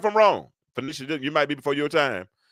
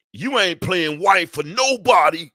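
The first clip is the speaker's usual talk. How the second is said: slow, drawn-out mock preacher voice, a bit louder; slow it down and lean on it, mocking him; imitating the man in the clip; 4 semitones higher, 5 dB louder, slower than usual. Host mocks the clip's relationship advice, slow and drawn out. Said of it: "he said".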